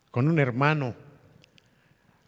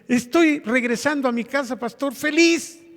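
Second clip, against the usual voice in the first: happy retold message; 10 semitones higher, 4 dB louder than usual. Neighbour's happy message quoted.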